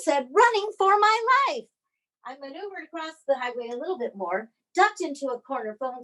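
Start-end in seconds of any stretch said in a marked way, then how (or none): 0.3-1.7 high, urgent answer; breathless panic. Retold or imitated. Answering Spender while fleeing the alarms, panicked. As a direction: blurt it out mid-escape.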